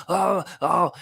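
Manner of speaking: sudden high, jumpy outburst; knee-jerk reaction. Acted. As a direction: react on reflex with a jolt of alarm.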